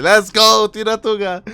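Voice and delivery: high, loud mock cheer; imitating a cheer. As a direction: cheer like a happy fan. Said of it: "I'm sure he'll be like".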